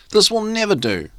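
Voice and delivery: high dismayed cry; cried out. A narrator's reading. Ministers crying out in dismay together. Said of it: "cried they".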